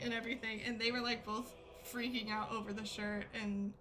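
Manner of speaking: ordinary talk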